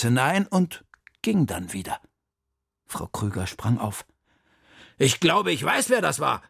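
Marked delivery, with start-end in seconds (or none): 5.0-6.4 higher, louder burst of realisation; aufgeregter Einfall. A narrator's reading. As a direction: blurt out a sudden hunch. Springt auf mit plötzlicher Idee. (aufgeregt, mit plötzlichem Einfall)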